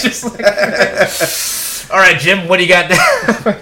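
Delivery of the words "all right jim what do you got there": loud laughing radio host voice; old-timey announcer. Retold or imitated. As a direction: toss to Jim like an old radio anchor. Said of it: "have that announcer voice".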